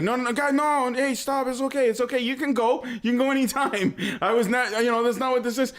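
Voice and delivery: pleading, hurried denial; mock frantic reassurance. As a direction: imitate a man hastily calming frightened kids. Retold man frantically reassuring kids.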